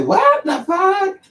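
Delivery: high, drawn-out mock outrage; slow high-pitched cry of disbelief; offended reaction. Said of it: "They'll say".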